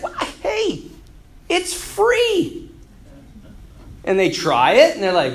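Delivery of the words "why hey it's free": high-pitched, easygoing sales pitch; playful coaxing. Acted. Alexander tempts reluctant people with free food, playfully coaxing. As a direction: coax them in a lifted voice.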